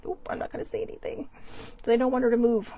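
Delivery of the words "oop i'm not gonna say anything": whispered inner thought; quick hushed aside to herself. Retold or imitated. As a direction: whisper it fast, as if not to wake the cat. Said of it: "'cause it's like".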